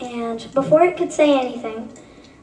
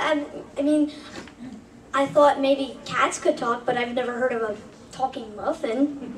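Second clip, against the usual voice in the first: ordinary talk, nothing marked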